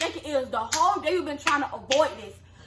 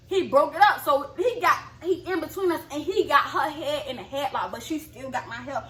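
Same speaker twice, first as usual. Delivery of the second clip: normal talking voice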